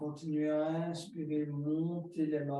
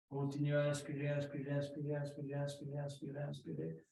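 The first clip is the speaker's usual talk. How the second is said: each inspirer softer, drifting down to near silence; fading repeated cue; 6 dB quieter, much faster than usual. Shown as a demonstration. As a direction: let the repeated cue fade out as the breath fills. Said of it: "coaching an inhale, repeated and fading". (répétition qui s'éteint peu à peu)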